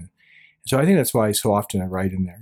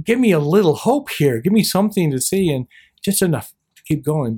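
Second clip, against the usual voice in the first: pleading; 6 semitones higher, 5 dB louder than usual. Retold or imitated. Pleading with God for hope.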